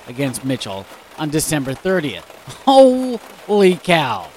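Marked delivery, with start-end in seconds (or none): 2.6-4.3 slow, loud, drawn-out holy cow; astonished. Real, not acted. Host reacting to a bizarre news story, drawn-out amazement. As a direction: gasp it out slowly in disbelief.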